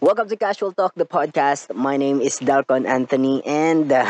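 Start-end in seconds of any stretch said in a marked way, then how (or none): 0.0-1.7 bright host welcome, Welcome pitched high; upbeat announcer intro. Acted. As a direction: announce the show name with a lift. Host announces show title. (masiglang pagbati ng host)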